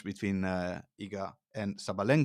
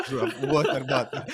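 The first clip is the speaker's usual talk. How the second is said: breaking into laughter while talking; laughing; 5 semitones higher, 7 dB louder than usual. Real, not acted. Telling co-host about the bottle thrown from the stands, laughing. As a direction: laugh through the words, louder.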